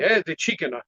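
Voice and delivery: put-on "Chikina" with Chinese accent and added final vowel; imitated Chinese accent. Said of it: "I like your Chinese accent".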